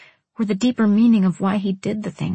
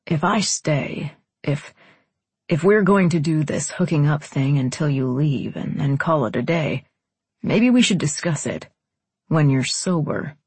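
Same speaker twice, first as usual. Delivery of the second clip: lowered man's voice, halting at first; hesitant man; 4 semitones lower than usual. Acted. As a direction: start haltingly, reason with her in a lower voice.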